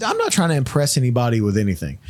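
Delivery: usual speaking voice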